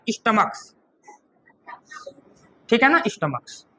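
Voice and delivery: स्टमक्स said softly with a clear ks ending; plural pronunciation. Shown as a demonstration. Teacher models the plural form for the class. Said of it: "तो क्या हो जाएगा?".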